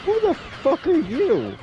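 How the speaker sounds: very high, slow incredulous voice; mock disbelief. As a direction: ham up baffled outrage. Mocking an unknown studio name.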